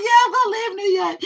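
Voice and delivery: high, laughing through the words. Retold or imitated. Telling the man she is leaving, laughing. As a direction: crack up while saying it. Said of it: "I said".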